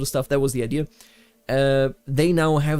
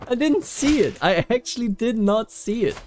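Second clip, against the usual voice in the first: blurting that he missed it; startled disbelief; 7 semitones higher than usual. Real, not acted. Gamer protests a missed event, high and startled.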